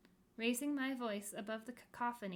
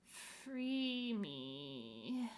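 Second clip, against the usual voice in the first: moaning. Acted. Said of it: "moaned a wide".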